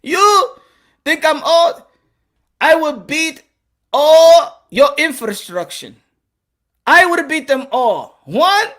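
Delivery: put-on halting, accented fighter's boast; affronted boast. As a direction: spit out the challenge word by word. Acted Pacquiao boasts after insult.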